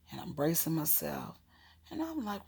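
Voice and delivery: ordinary talk, nothing marked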